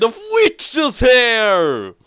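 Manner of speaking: slow, loud, hammed-up reveal; dramatic shout. Reading the dad's witch-hair mustache line, hammed-up shout. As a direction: belt out the punchline slowly.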